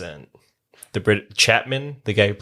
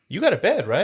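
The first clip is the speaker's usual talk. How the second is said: mock character voice; 8 semitones higher, faster than usual. Repeating the impersonated line.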